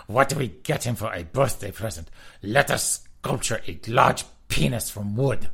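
lightly voiced campy German friends; German queens. Host voicing friends planning a prank gift, lightly campy. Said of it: "German queens".

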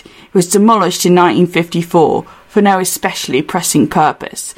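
put-on British accent, reading aloud as Hutch; lightly theatrical English accent. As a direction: read it in a put-on London accent. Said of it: "central London accent".

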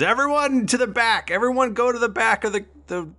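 high, urgent command; flustered guard calling out orders; guard giving orders. Acted. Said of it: "he goes".

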